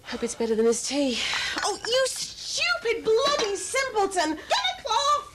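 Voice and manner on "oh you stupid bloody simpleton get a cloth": exasperated, rising to a shout; angry scolding. Acted. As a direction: scold him sharply, pitch climbing. Mother berates clumsy son.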